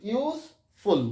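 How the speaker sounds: Useful split into ইউজ, pause, ফুল; syllable by syllable. Shown as a demonstration. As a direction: say useful slowly with a clear break before ফুল. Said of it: "দেখো, উচ্চারণ করে বেরিয়ে যাবে". (Useful ভেঙে ভেঙে, মাঝে থেমে)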